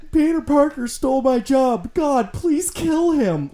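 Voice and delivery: high-pitched, drawn-out whine; mock whining. Fan imitating the villain griping about Peter Parker, whining. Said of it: "like, whining".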